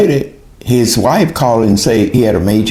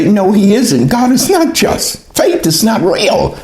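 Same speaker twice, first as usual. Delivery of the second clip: retold protest, pitched high; vehement denial; 9 semitones higher than usual. Woman rebutting friends who say God is great, vehement. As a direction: contradict them hotly in a raised pitch. Retells arguing against friends' faith.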